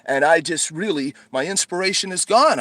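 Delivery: ordinary delivery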